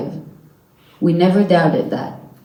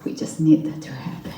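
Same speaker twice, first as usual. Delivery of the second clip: breathy whisper, barely voiced, a little fast; whispering the wish that her sister comes home; 7 dB quieter, faster than usual. Real, not acted. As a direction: let it drop to a whisper as the hope sinks in. Emotional end of hostage story.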